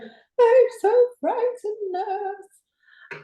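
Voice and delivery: frightened patient. Retold or imitated. Patient telling her nurse she's scared, high, slow frightened plea. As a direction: voice the scared old lady pleading, high and drawn out. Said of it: "but she was so frightened".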